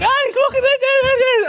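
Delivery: very high slow laughing call; excited call. Retold or imitated. Dad calling his son through the bush, high and laughing. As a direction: squeal it out, half laughing. Shouting to son while stamping.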